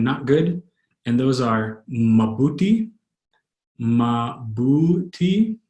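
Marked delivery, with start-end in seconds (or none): 1.9-5.6 mabuti very slowly, each syllable drawn out. Shown as a demonstration. Teacher sounding out a new Tagalog word, syllable by syllable. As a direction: stretch out "mabuti" for learners.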